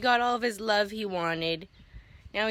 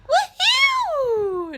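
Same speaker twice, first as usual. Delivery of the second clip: much higher, loud whoop of joy; cheer; 10 dB louder than usual. Real, not acted. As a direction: let out a big happy whoop.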